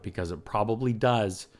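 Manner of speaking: ordinary talk, nothing marked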